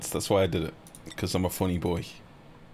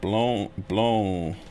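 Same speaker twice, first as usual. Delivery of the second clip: usual speaking voice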